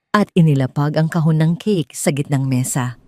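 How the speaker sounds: ordinary delivery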